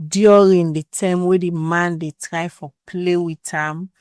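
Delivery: normal talking voice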